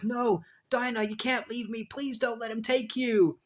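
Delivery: high, pleading cry; desperate pleading. Retold or imitated. Hero begging his dying love to stay, desperate plea. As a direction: beg her not to go. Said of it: "Mister Immortal's like".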